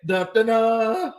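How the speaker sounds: slow, high dramatic sting chanted; dramatic dun dun dun. Pretend. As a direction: sing it like a suspense jingle. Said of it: "my dun, dun, dun sound kinda weak".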